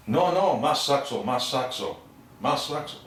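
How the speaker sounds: a crowd egging the player on; eager demand. Retold or imitated.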